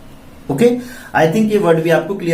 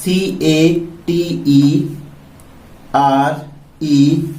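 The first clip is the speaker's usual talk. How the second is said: letters drawn out slowly; teacher spelling a word for the class; much slower than usual. Shown as a demonstration. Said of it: "ये word सी से start होता है".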